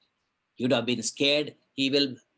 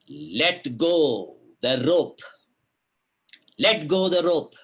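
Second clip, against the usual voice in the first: a booming voice from above, slow then louder; mild mock heavenly command, rising louder; divine voice; 7 dB louder, slower than usual. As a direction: call down like a voice from heaven. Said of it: "divine voice, Akashavani".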